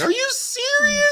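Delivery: high, incredulous imitation of the sister; mocking sister. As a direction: squeak it out like she can't believe it. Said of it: "she's sitting on the couch laughing, like".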